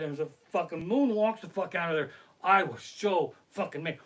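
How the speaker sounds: ordinary talk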